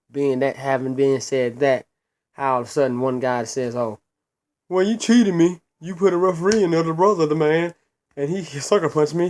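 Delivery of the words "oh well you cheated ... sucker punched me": higher-pitched mocking imitation of a crybaby; mock whining complainer. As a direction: imitate a sore loser's complaint, pitched up and louder. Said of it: "Stop crying".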